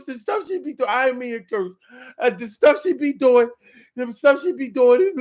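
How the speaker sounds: high-pitched hammed-up crying plea; mock tearful yelling. [name] excuses himself to her mom, hammed-up crying. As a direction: plead loudly, pretending to sob. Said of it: "yelling, screaming, and crying and lying".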